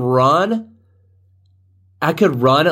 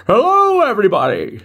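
Bane; 10 semitones higher than usual. Acted. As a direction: do a badly faked masked villain, pitched way up. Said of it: "make me look like Bane from Batman".

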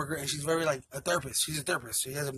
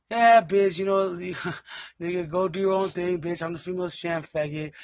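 loud mock-angry rant with a laugh breaking in; retold mad voicemail; 3 semitones higher, 7 dB louder than usual. Boyfriend leaving girlfriend a voicemail, retold anger. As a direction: act out an insulting message, half amused. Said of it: "I get mad at her".

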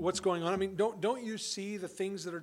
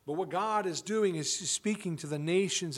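usual speaking voice

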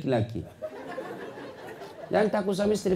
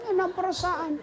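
high relieved sigh; 5 dB quieter than usual. Acted. Voicing a relieved wife.

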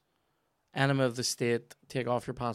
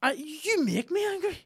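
mock angry; 3 dB louder than usual. Mock anger in banter.

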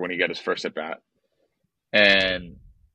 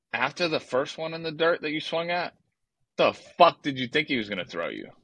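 retold irritation; 7 semitones higher, 3 dB quieter than usual. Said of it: "I was so irritated in that moment".

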